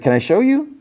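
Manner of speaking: imitating an excited Solo pitching way up. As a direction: squeal it out with eager delight. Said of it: "but then he gets excited".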